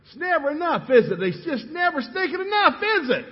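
high, loud frustrated yell; screaming. Retold or imitated. Husband screaming at his wife over the bills, high loud yell. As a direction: blow up, screaming the complaint. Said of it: "I just started screaming".

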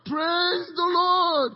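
slow, loud, high praise cry; drawn-out shout. Acted. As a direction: stretch it out like a battle hymn. Singers voiced praising.

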